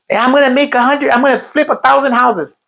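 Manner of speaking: high-pitched hyped-up boast; exaggerated, high rah-rah promise; mock affirmation. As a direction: parody someone pumping themselves up. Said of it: "Affirmations are".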